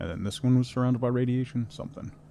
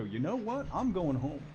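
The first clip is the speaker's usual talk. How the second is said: fed-up quote; 6 semitones higher, 8 dB quieter than usual. Retold or imitated. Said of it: "you just go".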